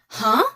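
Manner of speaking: questioning "huh" shooting up, loud; loud "huh" swept upward; rising second tone. Shown as a demonstration. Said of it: "It just sounds like".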